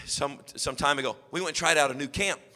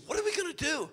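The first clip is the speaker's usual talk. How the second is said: much higher, softer wife's question; worried wife; 12 semitones higher, 5 dB quieter, faster than usual. Retold or imitated. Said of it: "My wife looked at me and said".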